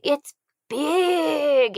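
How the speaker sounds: high, loud, big demo voice. Shown as a demonstration. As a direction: make the voice big, as if for a baby. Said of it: "make your voice big".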